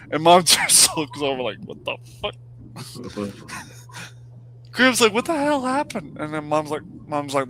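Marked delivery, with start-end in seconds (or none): 1.6-2.3 voiceless, hushed disbelief; stunned whisper. Retold or imitated. Mom looks over at sedated son, stunned whisper. Mom's stunned look acted out. 5.1-6.2 high-pitched imitation of a baffled reaction; startled disbelief. Relative on the phone hears it, high-pitched astonishment.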